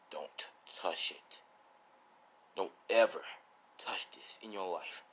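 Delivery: low, hushed, slow warning; serious mode. Acted. Swordsman grabbing All Might's hand to protect the blade, deadly serious. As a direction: warn slowly under your breath. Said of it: "As he went serious mode".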